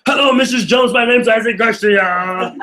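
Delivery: loud, high, pushy phone greeting; overbearing. Shown as a demonstration. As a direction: come on way too strong on the phone. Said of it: "Are you overbearing?".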